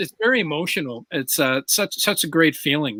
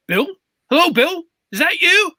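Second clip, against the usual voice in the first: higher, surprised call of a name across a street; 11 semitones higher, 3 dB louder than usual. Retold or imitated. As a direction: call out, half unsure it's him. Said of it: "he's like".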